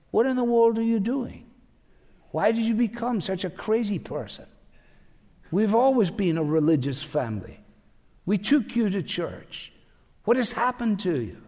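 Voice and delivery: high, incredulous family voice; bewildered family. Retold or imitated. Family members confronting a new convert, high incredulous protest. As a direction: protest like an alarmed relative, pitched high. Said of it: "the other members of the family say".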